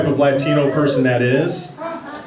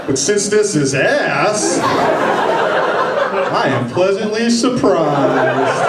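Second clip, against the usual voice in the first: slow, mock-thoughtful musing; imagined inner voice; much slower than usual. Imagined diner musing over his meal, slow and mock-pleased. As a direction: savor each word like a food critic. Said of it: "if your thoughts are like".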